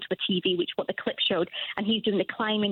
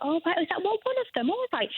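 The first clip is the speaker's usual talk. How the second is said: high, surprised; 9 semitones higher than usual. Retold or imitated. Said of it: "And I was like".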